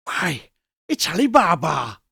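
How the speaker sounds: delighted cry of recognition; light exclamation of joy; exclaiming in ecstasy. A narrator's reading. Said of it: "exclaimed in ecstasy".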